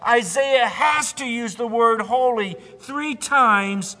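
loud, high build to a shouted climax; full-force pulpit crescendo; fervent preaching. Real, not acted. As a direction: build in power, then cry out the last word. Sermon climax on God's holiness.

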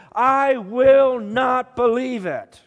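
loud, high, slow, defiant insistence. A narrator's reading. Thomas digs in after a week, fiercely emphatic. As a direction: pound out every word at full emphasis. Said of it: "Thomas was growing more and more emphatic".

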